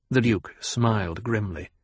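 ordinary delivery

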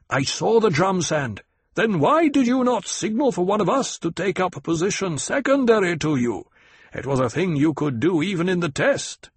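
a hint of a chiding desert captain; Stilgar's voice. A narrator's reading.

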